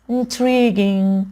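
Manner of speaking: intriguing with "ee" stretched way out; intriguing as in-TREEE-ging; long drawn vowel. Shown as a demonstration. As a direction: slowly draw out the middle of intriguing. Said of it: "این ایش کشیده است".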